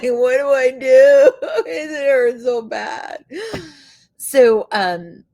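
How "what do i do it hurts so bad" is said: whiny plea half laughing, half crying; louder, higher teary complaint through laughter; laughing whine. Retold or imitated. Calling husband about her sore shoulder, laughing whine. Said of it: "I'm like".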